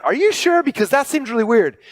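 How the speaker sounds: skeptical, half-laughing double take; incredulous question. Retold or imitated. To the bone broth doctor, retelling, a high mock incredulous question. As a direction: pitch jumps way up in mock disbelief on "are you sure".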